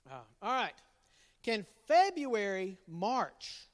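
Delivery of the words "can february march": slow, deliberate question; slow riddle. Shown as a demonstration. Dad slowly posing the riddle to family. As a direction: draw the riddle out.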